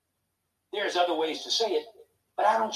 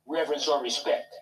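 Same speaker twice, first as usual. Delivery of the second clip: ordinary delivery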